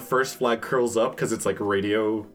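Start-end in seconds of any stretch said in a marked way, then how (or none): none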